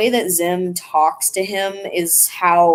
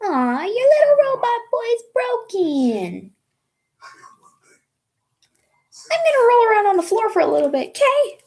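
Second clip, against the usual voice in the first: put-on much higher cooing baby voice; baby talk; 13 semitones higher than usual. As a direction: coo at the robot like a pet. Cooing at a broken game robot.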